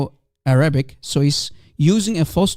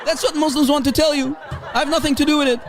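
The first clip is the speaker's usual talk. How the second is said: laughing mockery; 7 semitones higher, faster than usual. Real, not acted.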